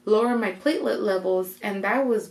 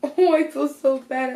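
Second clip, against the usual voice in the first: laughing; 5 semitones higher, 5 dB louder than usual. Real, not acted. Laughs remembering ignoring her.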